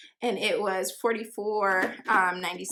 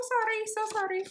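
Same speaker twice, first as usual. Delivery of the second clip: high-pitched, light "sorry"; squeaky-high playful sorry; playful apology; 12 semitones higher than usual. Real, not acted.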